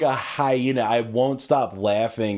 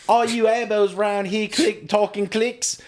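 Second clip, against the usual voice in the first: higher pompous mock voice; mock colonist voice; 7 semitones higher than usual. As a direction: announce it like a smug colonist.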